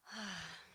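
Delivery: soft, falling sigh; real sigh. Real, not acted. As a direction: let out a breath to settle yourself. Collects herself before thanking.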